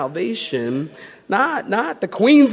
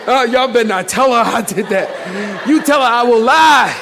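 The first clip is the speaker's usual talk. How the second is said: joking threat through laughter, building louder; amused, punched-up mock threat; laughing warning; 4 dB louder than usual. Real, not acted. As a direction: warn the crowd while cracking up.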